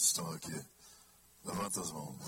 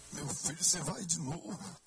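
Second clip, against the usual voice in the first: higher, pleading mother voice; fretting mother; 10 semitones higher than usual. Retold or imitated. Son mimicking his mother scolding him for praying, higher pleading voice. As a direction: plead like a worried mum. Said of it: "Eu digo".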